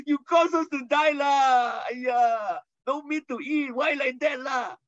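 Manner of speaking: high, shouted Singlish whine; whining complaint. Retold or imitated. Israelites grumble to Moses, high shouted Singlish whine. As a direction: wail it like a spoilt grumbler. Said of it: "Complaining, whinging, whining".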